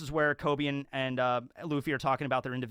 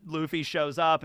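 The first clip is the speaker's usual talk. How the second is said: ordinary delivery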